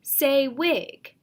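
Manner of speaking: firm teacher calling out a word; teacher prompt. Shown as a demonstration. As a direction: clear classroom prompt to a student. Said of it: "Teacher says".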